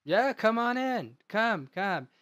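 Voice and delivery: high, loud, boozy welcome; a drunk thrilled to see an old buddy; jolly drunk. Acted. Drunk John waves them in.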